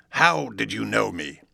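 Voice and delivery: slowed, strange-toned Hyde; slow menacing question. A narrator's reading. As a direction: press him slowly and darkly. Hyde presses the lawyer.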